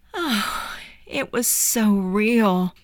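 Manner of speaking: high, breath-led relief; slow, high-pitched relieved boy; relieved sigh. Acted. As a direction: let the fear out on a sigh, then speak slowly. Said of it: "He took a satisfying breath and said".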